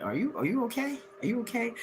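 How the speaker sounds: high, soft sceptical question; mock concern. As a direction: ask softly, as if doubting their sanity. Mock concern at ex's grudge.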